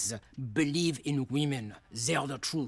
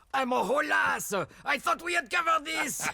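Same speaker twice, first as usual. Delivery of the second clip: exasperated outburst; 12 semitones higher, 4 dB louder than usual. Acted. Exasperated painter snaps at question.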